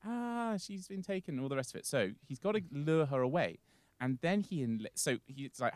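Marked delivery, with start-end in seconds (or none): 0.0-1.3 high-pitched mock wail; mock alarm. Nymphs telling Demeter of the kidnap, hammed-up panic.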